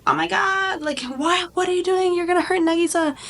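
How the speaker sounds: high-pitched panicked outcry; squeaky alarmed protest; alarmed classmates. Retold or imitated. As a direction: shriek it like frightened kids watching their teacher. Classmates panic at teacher's attack.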